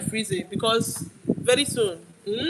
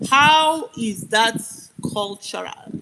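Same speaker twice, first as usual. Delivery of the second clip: slow, loud, emphatic start dropping on the last word; indignant challenge; 4 dB louder, much slower than usual. Real, not acted. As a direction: demand it slowly, word by word. Indignant rhetorical question about the artists.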